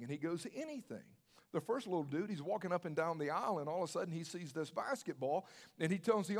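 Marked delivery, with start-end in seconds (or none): 0.5-1.0 high, soft retold answer; soft confirmation. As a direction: gently assure them, pitched up and hushed. Owner confirms his offer to kids.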